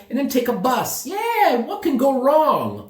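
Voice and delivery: high-pitched, gung-ho self-quote; mock enthusiasm. Traveler recalling his crazy bus plan, mock enthusiasm.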